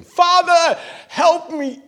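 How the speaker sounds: high, loud cry for help; desperate cry. Acted. Preacher acting out a desperate prayer, crying out loud. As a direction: call out to the Father in distress.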